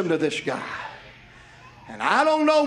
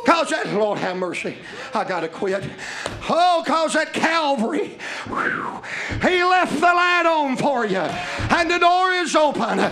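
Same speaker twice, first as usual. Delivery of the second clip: preaching invitation; 8 semitones higher, slower than usual. Acted. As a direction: call them in from the pulpit. Preaching at full force.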